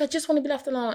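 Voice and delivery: high-pitched imitation of an upset boy; retold upset child. Crying son asking his mother for space, retold mild upset. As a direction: lightly mimic a child in meltdown. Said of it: "the boy was just an emotional wreck".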